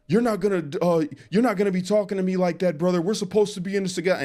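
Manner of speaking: high, loud imitation of a pushy inmate; mock indignant Jake. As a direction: squeak out an offended comeback. Jake confronts him, retold.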